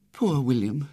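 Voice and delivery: mildly sorrowful sigh of pity; sad, pitying. A narrator's reading. Remorseful sister leaving her sick brother, sad. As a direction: let it sink with regret. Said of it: "looked at him sadly, sighed, said".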